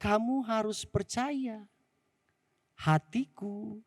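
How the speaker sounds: put-on calm the boy, slowly; gentle father quieting his son; reassuring father.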